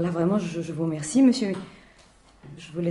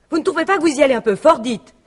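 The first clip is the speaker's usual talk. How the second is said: fast, louder, indignant reproach; indignant protest; 5 semitones higher, 5 dB louder than usual. Acted. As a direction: push back hard, offended. Widow protests to the commissioner. (reproche indigné, rapide et plus fort)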